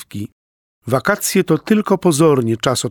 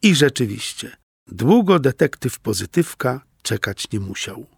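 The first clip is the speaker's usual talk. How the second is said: ordinary delivery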